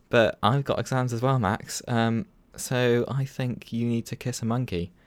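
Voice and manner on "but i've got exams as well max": ordinary talk, nothing marked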